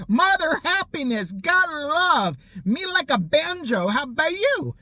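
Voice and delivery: high, slow mock crazy lady; sing-song it high like an unhinged neighbour. Mocking the crazy neighbour's sign in a high slow voice.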